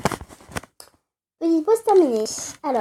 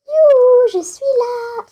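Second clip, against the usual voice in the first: high, loud sing-song call of youhou; calling out loudly; 11 semitones higher, 6 dB louder, slower than usual. Pretend. Player calling to Eva, high loud youhou call. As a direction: shout it up high like calling across a room.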